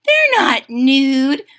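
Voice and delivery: high, loud, slow correction; contradicting little sister. A narrator's reading. Jilly Boo corrects Jujubee, high protesting voice.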